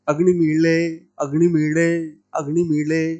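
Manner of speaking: अग्निमीळे repeated with the retroflex ळ flap; Vedic pronunciation. Shown as a demonstration. Teacher drills students on the Vedic ळ sound. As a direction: drill अग्निमीळे, curling the tongue back on ळ each time. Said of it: "देखो ये उच्चारण".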